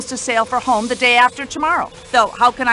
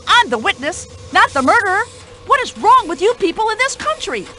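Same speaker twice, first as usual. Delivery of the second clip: indignant outrage; 9 semitones higher than usual. Acted. Outraged witness scolds detective.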